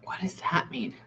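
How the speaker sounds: breathy whisper to herself; whispered aside. Real, not acted. Medium asking the spirit under her breath, whispered. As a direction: ask it under your breath, unvoiced.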